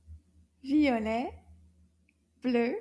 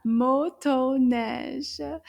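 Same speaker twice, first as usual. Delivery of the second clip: Motoneige drawn out in three loud beats; syllable by syllable; 6 dB louder, much slower than usual. Shown as a demonstration. Teacher confirming three syllables, Motoneige slowly beaten out. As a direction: count out Motoneige, one strong beat per syllable. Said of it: "I counted trois syllabes".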